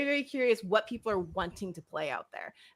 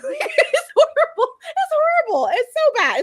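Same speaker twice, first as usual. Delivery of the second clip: much higher, louder, through real laughter; real laughing self-reproach; 11 dB louder than usual. Real, not acted. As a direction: squeal it through helpless laughing at yourself. Laughing at own absurd prejudice.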